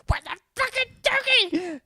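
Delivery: shrill high-pitched yell offstage. Retold or imitated. Actress yelling at the cast backstage, high furious yell. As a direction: screech it like a frantic stage mom. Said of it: "yells at all of us".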